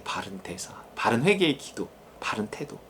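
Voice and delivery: hushed whispered echo. Shown as a demonstration. Preacher echoing his point to the congregation in a whisper. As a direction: breathe the phrase as a whisper.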